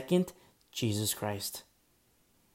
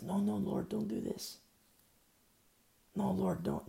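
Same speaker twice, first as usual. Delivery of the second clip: higher and softer, begging the Lord; pleading Moses; 8 semitones higher, 4 dB quieter than usual. Retold or imitated. Moses pleading with God in a hushed, begging voice. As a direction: Moses begs God to spare Israel, softly pleading.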